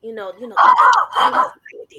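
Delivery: hushed confiding whisper; stage whisper. Acted. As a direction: lean in and whisper it. Whispered aside to grandma.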